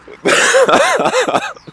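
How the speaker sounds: high rising giggle; squeaky laugh. Real, not acted. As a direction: let out a giddy squeak of laughter. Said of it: "losing my goddamn mind".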